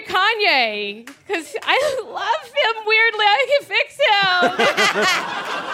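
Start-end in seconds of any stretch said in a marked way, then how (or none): 1.3-5.7 high, drawn-out comic plea; half-laughing swoony insistence; hammed-up lovestruck confession. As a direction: stretch the last words out, pitched way up.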